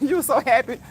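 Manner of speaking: laughing as you speak; tickled. Real, not acted. Streamer recalling a viewer's happy morning, tickled. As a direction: let giggles run into the words. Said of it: "I was too tickled".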